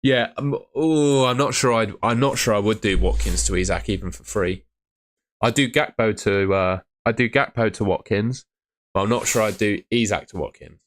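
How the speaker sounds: usual speaking voice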